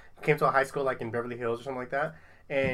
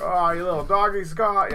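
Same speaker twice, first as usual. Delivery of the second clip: quick mock Australian-ish accent, higher and louder; imitated movie accent; 4 semitones higher, 7 dB louder than usual. Podcast host mimics Heath Ledger's movie accent, playfully. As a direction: toss off the film character's accent. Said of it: "I liked his accent in it".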